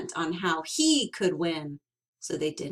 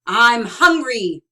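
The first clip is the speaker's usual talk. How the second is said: much louder, pleading cry; cried out; 3 semitones higher, 12 dB louder than usual. Acted. Sorrel crying out to Bruce that he is hungry. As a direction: cry out the need. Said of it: "cried Sorrel".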